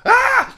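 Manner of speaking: very loud, high comic shriek; hammed-up horrified "Ah!"; mock scream. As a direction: scream it right in their face, jokingly. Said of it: "point in their face and go".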